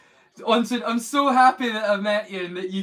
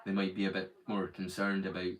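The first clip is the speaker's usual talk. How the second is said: much lower, softer relaxed voice; relaxed natural low voice; 10 semitones lower, 6 dB quieter than usual. Shown as a demonstration. Showing viewers his real relaxed voice, much lower and softer. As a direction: let the voice sink to its natural low. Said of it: "I talk like this".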